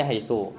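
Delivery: haitsu with a final u; u ending. Shown as a demonstration. Teacher showing one dialect's reading, haitsu ending in u. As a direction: end haitsu on an u vowel. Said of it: "Sebagian dialek bacanya".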